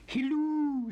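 high, loud sing-song hello cooed into the phone; cooing. Acted. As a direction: coo it like a kiss down the line. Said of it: "she cooed".

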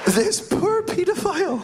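high, squeaky laughing sympathy; high-pitched amused lament; mock pity. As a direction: squeak it out as if pitying him. Mock pity for the failed culprit.

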